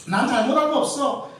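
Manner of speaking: 높고 큰 소리로 발뺌하는 흉내; 자기는 결백하다는 듯 세게 우기기; 당당한 부인 흉내. Acted. 잘못을 부인하는 사람 흉내. (목청 높여 뻔뻔하게 잡아떼는 척)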